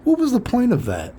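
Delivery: high incredulous what-was-the-point; exasperated disbelief. Retold or imitated. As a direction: squeak it out in disbelief. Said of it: "and I'm like".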